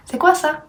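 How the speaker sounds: "C'est quoi ça" with the voice going up on "ça"; high-pitched "C'est quoi ça", rising at the end; question rise. Shown as a demonstration. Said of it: "make your voice go up at the end".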